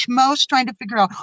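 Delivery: ordinary talk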